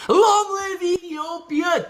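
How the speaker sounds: loud, high, drawn-out cheer; rallying cry. Pretend. Streamer thanking a super chat donor, loud rallying cry. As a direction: belt out the slogan slowly and high. Reads out viewer's slogan as cheer.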